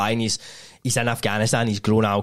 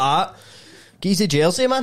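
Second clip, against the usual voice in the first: mocking Glasgow ned voice; 8 semitones higher than usual. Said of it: "You're gonna be like".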